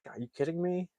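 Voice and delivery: high, soft mock disbelief; incredulous. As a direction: imitate quiet, squeaky-high disbelief. Acted Rusty refused a shuttle seat.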